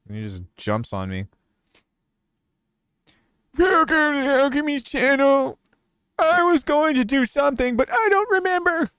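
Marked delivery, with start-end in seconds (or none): 3.6-8.8 put-on high, loud cracking old man greeting; other old man voice. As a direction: greet your viewers like a wheezy grandpa. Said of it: "old man voice".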